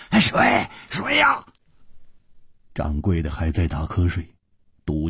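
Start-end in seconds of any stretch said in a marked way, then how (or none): 0.1-1.5 high-pitched desperate cry for water; parched man shouting in panic; hoarse frantic cry. A narrator's reading. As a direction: cry out wildly, voice straining high. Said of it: "他嘶声狂呼着说".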